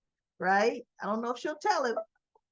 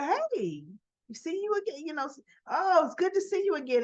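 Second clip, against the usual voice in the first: higher-pitched imitation of a happy hello; retold excited greeting; 6 semitones higher than usual. Friends almost bump into each other, excited greeting. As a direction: greet them in a high, pleased voice.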